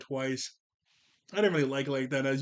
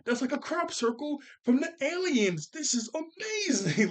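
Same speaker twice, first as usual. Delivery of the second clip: much higher, thrilled, slowing on the last words; amazed kid; 10 semitones higher than usual. Acted. Dil gushing to Tommy over the alien crop circle, thrilled. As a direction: gush over the alien sign. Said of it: "he goes".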